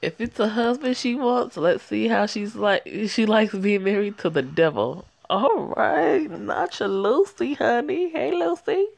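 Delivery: loud, high hype; gleeful, cheering the twist on; shouting happily at the screen; excited. Real, not acted. Hyped reaction to the plot.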